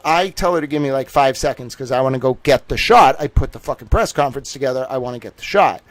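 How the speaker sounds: ordinary delivery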